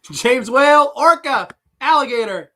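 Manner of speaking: high, loud quiz-host shouts; punchy, high-pitched announcing; excited calling out. Real, not acted. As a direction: call each guess out high and loud. Calling out players' guesses.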